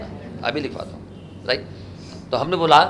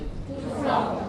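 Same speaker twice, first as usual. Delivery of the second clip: class answer; 13 semitones higher, 7 dB quieter than usual. Real, not acted. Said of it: "क्या होती है?".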